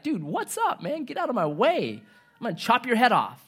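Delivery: a lightly hammed-up arrogant ruler; cocky tyrant. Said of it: "Antiochus Epiphanes is like".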